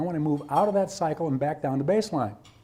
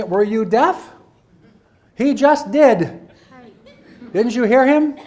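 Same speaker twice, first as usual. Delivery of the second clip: loud, higher mocking disbelief with a laugh; sarcastic retort; 5 semitones higher, 8 dB louder, slower than usual. Teacher imagining a sarcastic reply to a colleague, loud mocking. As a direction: needle them with a laughing, incredulous jab. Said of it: "I always wanna say".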